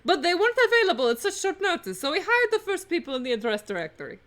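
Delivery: high mock-French drifting into Russian; put-on accent sliding eastward to Russia; French accent turning Soviet. Prisoner deflating her hired lawyers, high Russian-tinged French accent. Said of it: "getting a little bit more Soviet".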